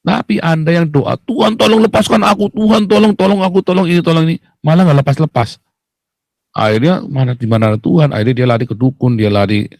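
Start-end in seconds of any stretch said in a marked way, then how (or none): 1.2-4.3 higher, urgent begging to God; frantic pleading prayer. Retold or imitated. Preacher imitates a believer begging to be rescued, high and frantic. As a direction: plead over and over as if in panic. Mocks a selfish desperate prayer.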